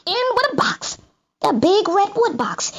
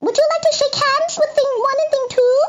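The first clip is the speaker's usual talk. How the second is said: sing-song greeting from two strange critters, much higher; lightly creepy twin creatures; Things' voice; 11 semitones higher than usual. A narrator's reading. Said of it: "They said".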